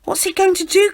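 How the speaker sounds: high, loud, alarmed question; alarmed cry. A narrator's reading. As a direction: cry out over the crowd. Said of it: "cries Ernie".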